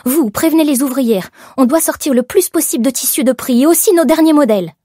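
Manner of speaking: high, forceful commands; urgent orders. A narrator's reading. Shop owner sending workers to save stock, urgent orders. Boss giving orders as fire spreads.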